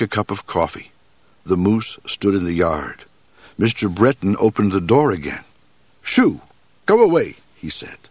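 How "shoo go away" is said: raised, higher shout to drive off an animal; shouting shoo. Acted. Cook shooing a moose from his back door, loud and high. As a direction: Breton yells at the moose to leave. Breton shoos the moose away.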